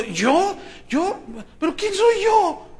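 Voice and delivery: who, me? climbing in amazement; bewildered disbelief. Acted. Mary startled by the angel, rising astonished. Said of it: "como diciendo".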